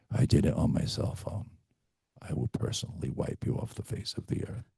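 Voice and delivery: breathy hushed menace, playfully; whispered mock threat. Coach to agents, mock threat in a whisper. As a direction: whisper the threat like a joke villain. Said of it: "the next person who says to me".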